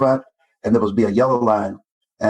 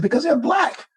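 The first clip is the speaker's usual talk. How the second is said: mock bigot voice. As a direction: lightly sneer it out as the bigot. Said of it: "He said".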